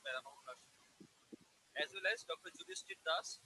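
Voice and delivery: very faint, distant voice; too soft to make out; barely audible. Real, not acted. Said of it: "Your voice is not audible".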